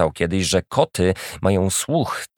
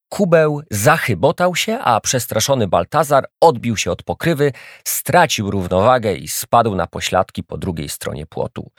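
usual speaking voice

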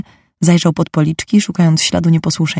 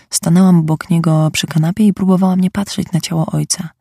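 ordinary delivery, nothing marked

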